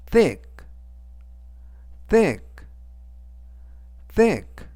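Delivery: thick, the th said as in 번데기 sound. Shown as a demonstration. Teacher showing students the th sound in thick. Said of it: "티에이치는 번데기 발음".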